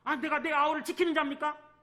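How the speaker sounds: indignant high, loud comeback; acted shameless retort, voice pitched right up; brazen, arrogant. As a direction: throw the question back defiantly. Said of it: "뻔뻔스러운 자인가".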